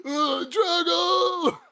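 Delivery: drawn-out high yell of Drago's name; slow, dopey bellow up the mountain; Rocky shout. Retold or imitated. Rocky on the mountaintop calling out, slow high bellow. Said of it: "and he's like".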